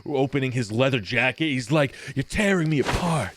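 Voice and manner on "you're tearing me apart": a touch of stage drama; theater kid emoting. Acted. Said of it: "very theater kid".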